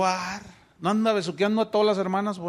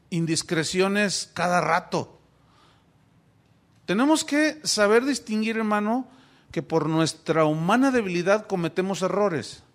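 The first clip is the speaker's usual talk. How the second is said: ordinary talk, nothing marked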